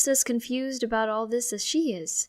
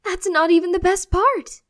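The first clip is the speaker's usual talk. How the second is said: much higher and louder, thrilled; gushing excitement; 11 semitones higher, 13 dB louder than usual. Acted. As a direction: tease the bigger news. Mom gushing about surprise.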